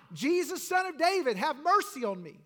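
high, loud plea for mercy; crying out. Acted. Blind man begging Jesus for mercy, crying out. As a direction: cry it out loudly over a crowd. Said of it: "starts crying out".

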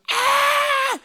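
piercing high shriek of fright; high terrified scream, full volume; grandma's scream. Retold or imitated. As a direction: shriek as a snake jumps out. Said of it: "and she's screaming".

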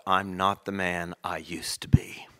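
whisper. Acted. Whispered close of Newton quote.

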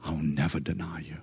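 very soft, low vow of Peter; a soft, hollow vow; hushed denial. Retold or imitated. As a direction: murmur the promise as if already doubting. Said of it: "Do you hear the denial in that".

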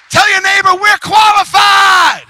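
very high, loud, stretched-out climax; triumphant preacher's shout. Real, not acted. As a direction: shout it to the whole congregation, drawn out.

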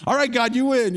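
put-on scared kid surrendering; giving up. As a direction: cry out to God in defeat. Kid gives up, under blankets.

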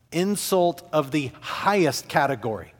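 ordinary talk, nothing marked

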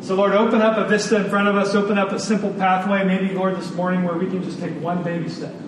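soft, earnest prayer; closing prayer. Acted. Pastor prays for the congregation, soft and earnest. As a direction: pray quietly over the church.

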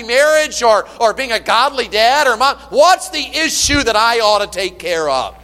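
loud, high, ringing pulpit delivery; full-force preaching. Acted. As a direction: preach it out to the whole church.

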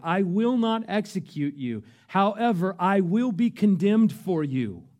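swelling declaration. Retold or imitated. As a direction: let Jesus' promise swell into a cry.

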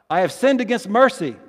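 loud, high-pitched line for response; forceful confession, projected high; emphatic call. Acted. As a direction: call it out for the congregation to answer. Leading congregation in call and response.

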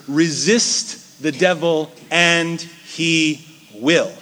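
each word drawn out slowly; slow scripture quote. Acted. Preacher reading the verse to his flock, slow and weighty.

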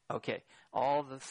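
normal talking voice

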